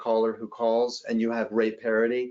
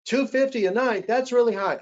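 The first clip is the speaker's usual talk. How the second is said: complaining guest; 12 semitones higher, 3 dB louder than usual. Retold or imitated. Guest balks at the rate.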